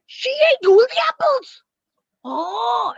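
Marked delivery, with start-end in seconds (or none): 0.1-1.6 high and loud with all excitement; excited exclamation. Shown as a demonstration. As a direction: burst out with delight. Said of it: "With all excitement".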